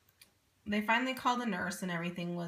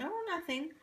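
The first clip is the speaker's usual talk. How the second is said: innocent denial; 9 semitones higher, 3 dB quieter than usual. Retold or imitated. Interpreter denies whispering.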